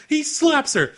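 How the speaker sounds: ordinary delivery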